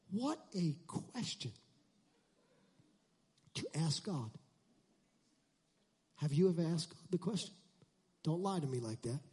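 hushed aside. Real, not acted. Preacher confiding to congregation, very soft. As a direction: drop the voice to a near hush.

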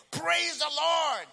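high, stretched-out cry of praise; jubilant shout. Acted. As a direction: shout thanks to heaven.

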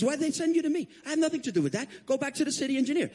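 high, exasperated, hurried brush-off; annoyed official. Retold or imitated. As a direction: protest and send him packing. Fire chief passing him on.

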